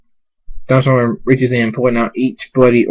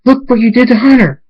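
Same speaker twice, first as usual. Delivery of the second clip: high, loud, horrified accusation; accusing shout; 12 semitones higher, 6 dB louder than usual. A narrator's reading. Pinkie blaming Thunderstorm for Hunter, accusing shout. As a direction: shout the blame at the culprit.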